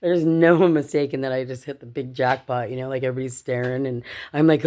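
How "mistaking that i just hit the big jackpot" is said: ordinary delivery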